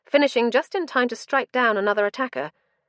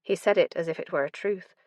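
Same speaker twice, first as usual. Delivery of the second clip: ordinary delivery, nothing marked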